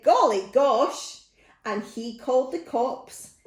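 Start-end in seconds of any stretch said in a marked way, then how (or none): 0.0-1.2 slow, loud exclamation; startled farmer. Acted. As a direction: exclaim as a farmer woken by a splash. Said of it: "the farmer woke and said".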